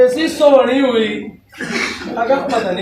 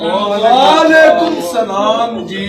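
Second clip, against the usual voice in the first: drawn-out greeting; 3 semitones lower, much slower than usual. Acted.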